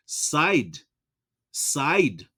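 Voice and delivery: said pronounced "side", long i; spelling pronunciation. Shown as a demonstration. Teacher reading said the way it's spelled, wrong long vowel. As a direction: rhyme said with "ride". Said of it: "The way it's spelled".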